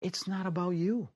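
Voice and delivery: high, soft voice in his head; a hushed voice from inside, gently correcting him; inner voice. Retold or imitated. An inner voice tells Chris to protect the girl, high and hushed. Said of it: "a voice says".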